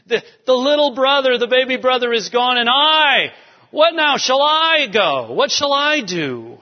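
preacher acting out the brother's despair; dramatic lament. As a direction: cry it out again with anguish. Preacher re-voices Reuben's lament.

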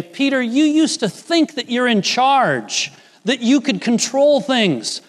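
earnest Jesus. Retold or imitated. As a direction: plead with Peter, climbing on the last word. Jesus voiced addressing Peter.

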